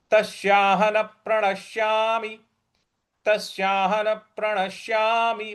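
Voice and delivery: intoned तस्याहन प्रणश्यामि, doubled p starting प्रणश्यामि; stress on प. Shown as a demonstration. Teacher modelling the join, stressed प in प्रणश्यामि. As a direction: push into प of प्रणश्यामि on a level tone. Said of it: "प पर हमको बल लगाना है".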